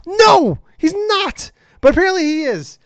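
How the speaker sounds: very high, loud protest; exasperated yell. Real, not acted. Host shouting down the interviewer he imitated, exasperated. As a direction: voice leaping up in disbelief.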